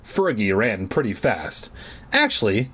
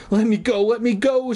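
a higher, pleading mock cry; 10 semitones higher than usual. Margaret pleading with Cooper to release her, mock frightened. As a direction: beg to be let go, pitched up. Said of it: "she cried".

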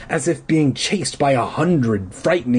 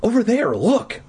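usual speaking voice

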